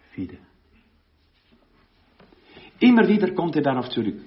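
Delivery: ordinary talk, nothing marked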